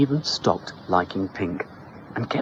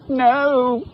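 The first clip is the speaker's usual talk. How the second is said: put-on high, loud childish wail; a hint of a wailing girl; 6 dB louder than usual. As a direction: whine the refusal. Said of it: "she wailed".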